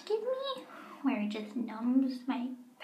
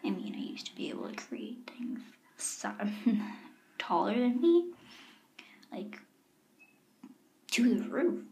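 normal talking voice

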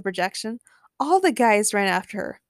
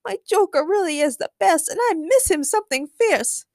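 high, wistful Harley voice; pining; 10 semitones higher than usual. A narrator's reading. Harley misses her Joker, pining. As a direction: lament in a high character voice.

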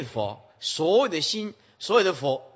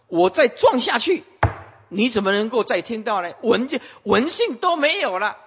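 quoting the Buddha, higher and earnest; lightly voiced Buddha teaching the monks; the Buddha explaining; 9 semitones higher, 4 dB louder than usual. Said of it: "佛陀说". (略带佛陀口吻，语调升高，恳切讲解)

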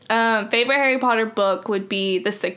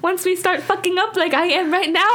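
put-on British accent; 8 semitones higher than usual. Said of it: "speak in a British accent".

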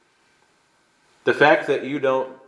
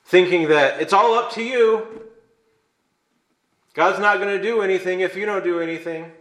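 higher, rising preacher's voice driving the point home; preaching at full force; 6 semitones higher than usual. Acted. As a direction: preach it out to the congregation, fervent and raised.

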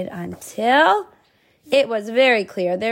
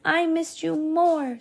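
tender reply; 7 semitones higher than usual. Acted. Tom answers tenderly.

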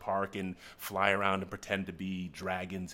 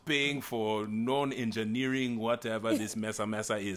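stern West African dad voice; West African accent; 5 dB louder than usual. Retold or imitated. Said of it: "He's doing my parents".

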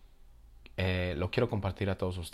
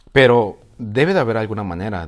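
desperate; 12 dB louder, faster than usual. Acted.

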